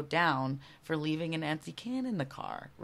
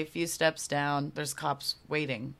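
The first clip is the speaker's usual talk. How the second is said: normal talking voice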